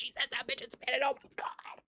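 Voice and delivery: hushed, breathy fan squeal; excited but almost voiceless; overwhelmed whisper. Real, not acted. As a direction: whisper it in disbelieving delight. Repeats it, overcome.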